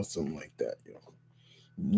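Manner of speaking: ordinary delivery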